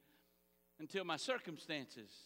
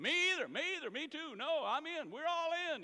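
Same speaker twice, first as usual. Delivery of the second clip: eager disciples chiming in; 10 semitones higher, 4 dB louder than usual. Acted. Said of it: "all the other disciples say".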